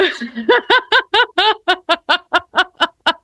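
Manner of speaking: long breathless fit of laughter; long helpless laugh. Real, not acted. Both laughing after flubbed word.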